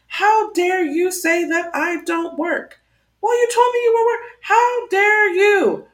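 slow, drawn-out "How dare you" with a high, clipped middle; mock indignant. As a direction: ham up prissy outrage. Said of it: "she started responding in kind".

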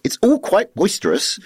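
high-pitched mock neighbour voice; complaining neighbour. Neighbours complaining about noisy tenants, mock complaint. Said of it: "speech bubbles coming from the window saying things".

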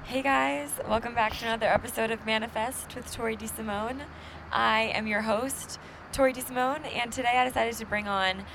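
put-on podcast welcome voice; podcast host intro. Shown as a demonstration. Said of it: "you have to say it like this".

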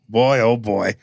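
usual speaking voice

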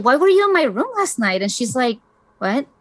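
ordinary talk, nothing marked

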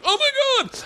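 very high, strained cry; mock waking scream. Comic waking from a shock dream, mock high scream. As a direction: jolt awake from a nightmare, squealing. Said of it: "I woke up like".

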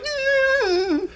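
very high-pitched strained whine; high muffled mock whimper; Iggy's muffled squeal. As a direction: squeal through a shut mouth. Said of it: "meanwhile, Iggy's like".

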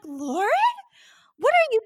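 high-pitched, confused just-woken questioning; retold groggy mom. Groggy Mom woken by her daughters' flashlight, confused. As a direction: imitate a mother squinting awake, puzzled. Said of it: "like, groggy".

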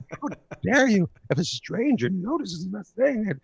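high, drawn-out indignant wife; mock outrage. Wife embarrassed by husband in public, mock outrage.

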